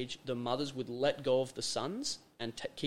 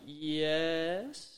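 drawn-out, higher, questioning yes; confused yes; 4 semitones higher than usual. Retold or imitated. Woman told her man won't go to war, imitated confused. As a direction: stretch it upward, unsure, imitating her. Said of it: "She's real confused".

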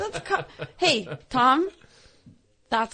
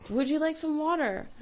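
slightly higher, patient polite repeat; repeating stewardess; 6 semitones higher than usual. Retold or imitated. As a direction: ask once more, kindly.

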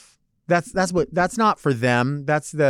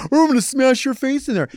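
high, put-on goofball voice; mock doofus; 9 semitones higher, faster than usual. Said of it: "like a doofus".

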